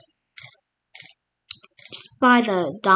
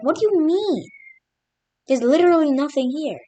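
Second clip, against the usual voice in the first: high, incredulous question; baffled; 8 semitones higher, 5 dB louder than usual. Retold or imitated. Algerians puzzling over an empty British claim, baffled. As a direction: sound thrown by the absurd map.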